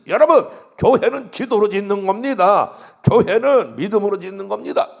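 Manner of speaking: loud proclamation. Retold or imitated. Pastor proclaiming to his congregation, loud and ringing. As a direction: ring it out like a pulpit declaration. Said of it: "이렇게 제가 선포했는데".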